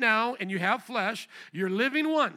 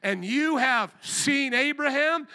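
slow, loud, raised question; incredulous challenge; 5 semitones higher, 5 dB louder, slower than usual. Acted. Crowd questioning Jesus about Abraham, incredulous. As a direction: stretch it out in disbelief. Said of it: "they said to him".

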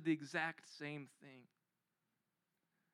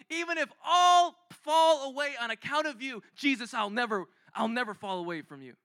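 higher and louder, calming at the end; Peter's fervent vow; 5 semitones higher, 6 dB louder than usual. Retold or imitated. Peter vowing never to leave Jesus, fervent. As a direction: declare it, then settle into promise. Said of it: "this is Peter talking".

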